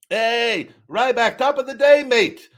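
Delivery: high, loud mock British accent; British fan accent. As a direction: play an eager UK fan calling out to you. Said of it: "Or, you know".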